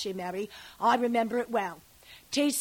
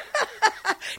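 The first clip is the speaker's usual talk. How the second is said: high cackle; 11 semitones higher than usual. Acted. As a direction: burst of high laughter. Nurse laughs at her memory.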